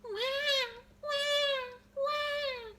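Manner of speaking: very high wah wah crying; baby wail. A narrator's reading. Baby teeth crying out, very high. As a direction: bawl like a baby. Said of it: "cried the baby teeth".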